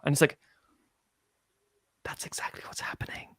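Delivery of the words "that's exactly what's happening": whispered, voiceless aside; whispered confirmation of the kid's line. Acted. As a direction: whisper it conspiratorially. Dramatic aside.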